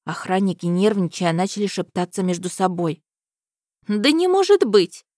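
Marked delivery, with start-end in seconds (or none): 3.9-5.0 high, loud startled disbelief; surprised princess. A narrator's reading. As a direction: gasp it out in disbelief. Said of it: "удивилась Мария".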